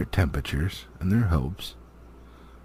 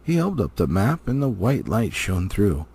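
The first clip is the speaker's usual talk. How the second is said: ordinary delivery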